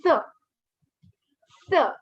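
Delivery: "S" short, with the pitch dropping; falling tone. Shown as a demonstration. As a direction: drop sharply through "S". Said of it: "your pitch drops".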